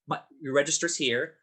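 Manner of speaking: ordinary delivery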